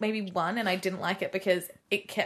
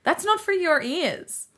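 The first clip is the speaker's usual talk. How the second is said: higher and much louder, laughing, a mock protest; laughing refusal; 6 semitones higher, 9 dB louder than usual. Keeping the podcast secret from mum, laughing protest. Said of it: "But I was like".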